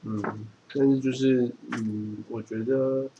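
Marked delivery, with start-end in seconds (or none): none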